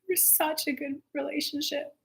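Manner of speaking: real tearful quiet, with sighs; on the verge of tears. Real, not acted. Speaker remembering beloved grandparents, close to crying. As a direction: soften as the tears well up. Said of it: "I'm gonna start crying".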